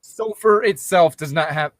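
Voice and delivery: usual speaking voice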